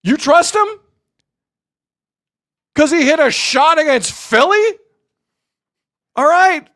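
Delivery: high, slow, exasperated rant; heated rant, pitch way up; angry rant. Real, not acted. Rant at chat about Kawhi.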